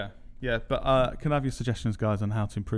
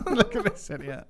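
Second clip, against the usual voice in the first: lightly raised presenter voice; echoing the mock intro; 9 semitones higher than usual. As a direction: repeat it like showing off a mascot. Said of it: "I like that".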